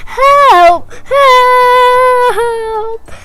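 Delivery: high, loud cries for help; hammed-up scream. Elephant with snapped nose calls for help, high mock screaming. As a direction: scream in mock panic, last one a long wail. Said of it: "and he screamed".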